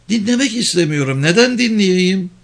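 high-pitched defiant refusal; refuse, pitched well up. A narrator's reading. Woman refuses to hear driver's warning, defiant.

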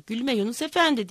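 plain reading voice

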